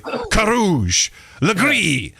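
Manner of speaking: Carouge and Legris drawn out in careful French; showy French pronunciation. Retold or imitated. Mocking English actors saying French names, very French pronunciation. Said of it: "very good pronunciation of a French word".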